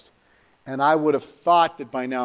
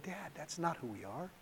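much softer, almost under the breath; soft rebuke; 2 semitones higher, 20 dB quieter than usual. Retold or imitated. Son imagines telling his dad this, softly. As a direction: say it gently to your father.

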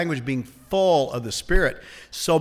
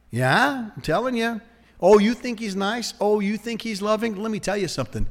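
higher, scoffing salesman-like tempter; insistent devil; 6 semitones higher than usual. Acted. Devil persuading Eve that God isn't loving, scoffing insistence. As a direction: press the doubt with mock pity. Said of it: "The devil said".

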